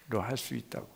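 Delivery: hushed encouraging voice; quiet reassurance. Retold or imitated. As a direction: reassure under the breath. Lord's quiet reassurance retold.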